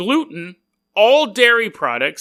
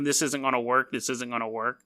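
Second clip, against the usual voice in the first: much lower, softer anxious inner voice; inner worry; 6 semitones lower, 8 dB quieter than usual. Retold or imitated. Recalling doubts about the segment, lower soft worried muttering. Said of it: "I was thinking".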